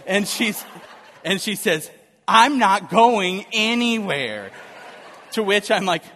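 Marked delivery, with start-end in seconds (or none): 2.3-4.5 slow, loud, higher mock-wife refusal; defiant refusal. Laboring wife refuses to go to the car, defiant. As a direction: imitate her digging in, drawn out and loud.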